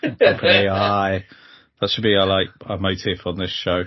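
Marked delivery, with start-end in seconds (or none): 0.0-1.2 slow, loud Ali G impression. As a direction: stretch it out like Ali G. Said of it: "like Ali G's".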